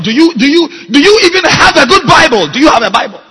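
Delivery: loud, climbing pulpit rebuke; preaching at full force. Acted. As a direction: hammer the congregation with each question. Pastor rebukes leaders from pulpit.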